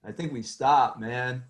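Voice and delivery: a hint of a low stoner voice. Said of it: "[name] and [name] is like".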